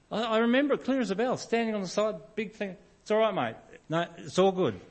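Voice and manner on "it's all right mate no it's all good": breezy brush-off. Retold or imitated. Reenacts himself brushing off help.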